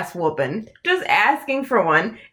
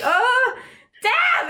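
much higher sing-song call; 13 semitones higher than usual. Retold or imitated. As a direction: call him again to get his attention. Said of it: "And I'm like".

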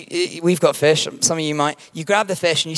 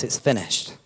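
low, softer, solemn final breath; hushed last words; 5 semitones lower, 6 dB quieter than usual. Retold or imitated. Preacher quoting Jesus on the cross, hushed. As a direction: let it fall gently like a dying word.

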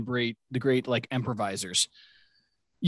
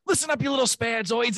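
put-on yelling at kids; 8 semitones higher than usual. Farley screaming at kids from boat.

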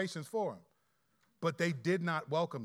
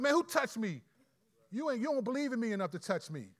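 mock angry; 5 semitones higher than usual. Preacher shows how Jesus did not say it, mock annoyed. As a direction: sneer it like an offended Jesus. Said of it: "he didn't say it angrily".

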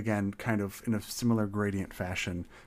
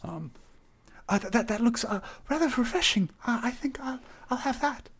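high, stammering nervous greeting; timid satyr voice; 14 semitones higher than usual. Acted. To the tavern crowd, a high, stammering shy hello. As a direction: squeak out a shy hello at the bar.

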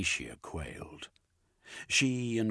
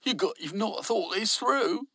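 objecting worker; 7 dB louder than usual. A narrator's reading.

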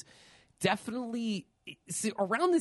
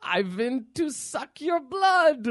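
vampire voice; 10 semitones higher, 4 dB louder, slower than usual. Acted. Vampire knock-knock punchline.

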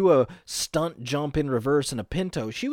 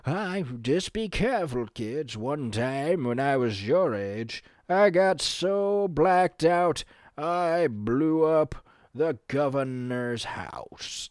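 slow, drawn-out elder's storytelling; old storyteller to kids; slower than usual. Acted. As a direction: stretch it out like an old-timer reminiscing. Voicing older self telling kids story.

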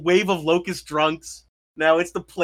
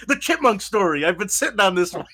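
higher-pitched, lightly acted newsroom guy; eager newsroom guy; 4 semitones higher, 3 dB louder than usual. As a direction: play a reporter pitching a long-held scoop. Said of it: "the one guy in the newsroom goes".

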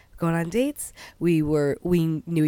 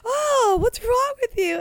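high-pitched mock-appalled whine; imitated appalled reaction, pitched way up; imitated dismay. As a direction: act out his dismay high and through giggles. Retelling his appalled reaction.

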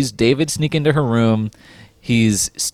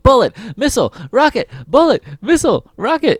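higher, rushing chant; speeding up; 10 semitones higher than usual. Retold or imitated. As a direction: fire the words off faster. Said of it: "she's saying it fast and fast".

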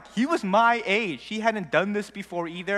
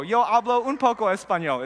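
playful Spanish; 4 semitones higher, 5 dB louder than usual. Retold or imitated. Showing off Spanish to classmates.

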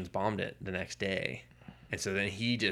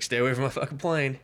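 mock threat; 6 semitones higher, 6 dB louder than usual. Retold pilot's threat.

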